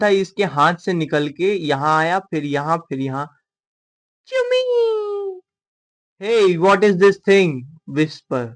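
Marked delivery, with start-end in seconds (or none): 4.3-5.4 very high, slow squeaky cry; tiny creature squeak. Acted. Squeaky stand voice. (ऊँची चीं-चीं आवाज़)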